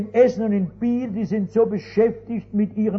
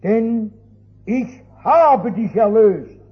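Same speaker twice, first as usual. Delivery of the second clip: solemn scripture reading. Retold or imitated. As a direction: read it like God's own promise, rising at the end. Reading Isaiah aloud, building. (feierliche Bibellesung, zum Ende hin lauter)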